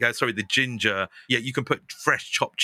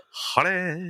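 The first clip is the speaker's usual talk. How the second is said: much higher, put-on posh greeting; 8 semitones higher than usual. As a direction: ham up dad's fake posh voice.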